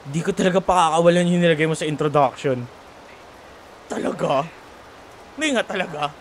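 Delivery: normal talking voice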